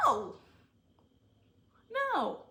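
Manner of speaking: high, loud outraged cry. Real, not acted. Reacting to Miles and Tristan dating, outraged. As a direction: cry it out in disbelief.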